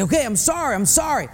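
high, brushing-off apology; dismissive sorry. Retold or imitated. Husband brushing off his wife, high and dismissive. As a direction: toss off the sorry to end it. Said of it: "when I say".

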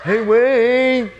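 high, drawn-out pestering call; Mike. Retold or imitated. Roommate calling through the door at night, pestering. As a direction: stretch the name out, high and loud. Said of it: "And it's Mike".

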